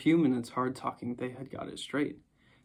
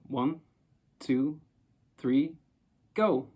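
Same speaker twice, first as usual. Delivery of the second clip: counting to jump; 5 dB louder, much slower than usual. A narrator's reading. Children holding hands, counting louder to jump.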